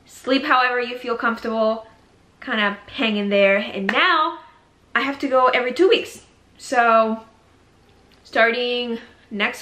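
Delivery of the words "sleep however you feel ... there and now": ordinary talk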